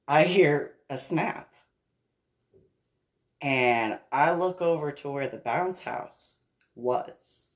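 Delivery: normal talking voice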